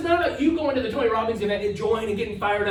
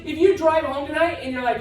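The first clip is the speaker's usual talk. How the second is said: ordinary delivery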